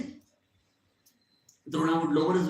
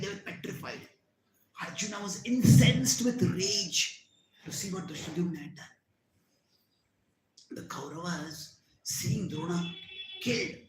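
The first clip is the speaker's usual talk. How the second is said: usual speaking voice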